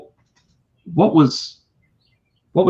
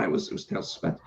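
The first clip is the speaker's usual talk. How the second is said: muttered answer; 8 semitones lower, 12 dB quieter, much faster than usual. Retold or imitated. Muttering answer under his breath.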